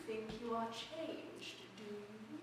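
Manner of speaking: quiet. Acted. Caterpillar drops to a hush.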